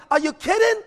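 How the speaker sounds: incredulous. Retold or imitated. And I'm like.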